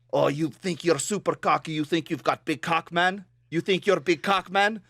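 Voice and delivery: mocking Russian caller; imitated Russian customer, goading and cocky; thick Russian accent. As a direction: taunt in a heavy Russian accent. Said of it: "in a super thick Russian accent".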